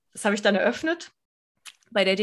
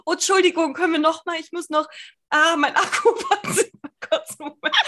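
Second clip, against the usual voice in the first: high, flustered imitation with laughter; nervös; 8 semitones higher, 3 dB louder than usual. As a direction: act out an apologetic panic, half laughing. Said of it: "Ich war so super nervös". (nervös und hektisch nachgespielt, hohe Stimme)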